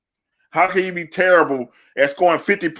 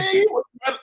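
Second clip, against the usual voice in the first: excited insistence; much faster than usual. Real, not acted. Hyped podcast host insisting Penny was better, excited. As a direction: pitch jumps way up, emphatic.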